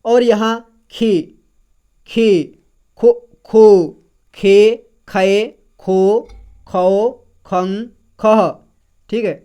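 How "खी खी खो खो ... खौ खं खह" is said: खी to खह drawn out slowly, one at a time; teacher sounding out each syllable; syllable drill. Shown as a demonstration. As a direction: recite खो, खे slowly for the class. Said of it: "तो इस तरह से पढ़ेंगे".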